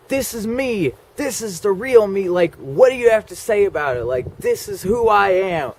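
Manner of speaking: high, loud declaration, chest out; defiant proclamation. Retold or imitated. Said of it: "So I was like".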